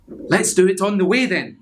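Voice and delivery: little skeleton. Acted. Little skeleton proposing the plan, high and eager. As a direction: chirp it out eagerly. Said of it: "said the little skeleton".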